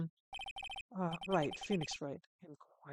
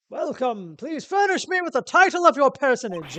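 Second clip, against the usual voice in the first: loud, high, pompous British voice; vaguely British accent; 7 semitones higher, 13 dB louder than usual. Acted. CEO greeting a visitor, pompous vaguely British accent. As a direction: boom it in a hammed-up British accent. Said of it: "everyone's vaguely British".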